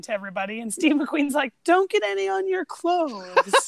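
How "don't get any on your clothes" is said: higher, slow, lightly voiced warning; mock warning. McQueen warns the men about the moonshine, slow mock warning. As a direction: warn them slowly, half joking.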